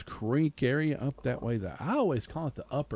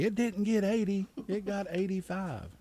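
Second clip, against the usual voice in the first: higher-pitched imitation of a nitpicking listener; mock complaining caller; 8 semitones higher than usual. Radio host mimicking a listener calling about the forecast, mock complaint.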